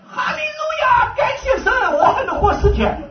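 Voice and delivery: high, loud cry of hallelujah, half laughing; joyful outcry. Retold or imitated. Testifying to the congregation, shouts a high, loud hallelujah. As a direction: burst out praising God with ecstatic joy.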